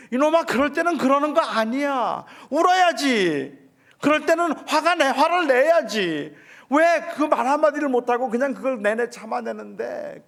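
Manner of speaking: loud, high anguished shouting; retold shout at son. Father shouting at his hurt son in the car, loud and high. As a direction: yell it out, heartbroken and pleading. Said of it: "그게 저는 마음이 너무 아파서".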